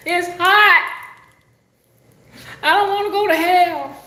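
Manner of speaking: high, loud hammed-up plea; mock wailing complaint. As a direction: cry out in exaggerated dread of the heat. Mock wailing about the heat.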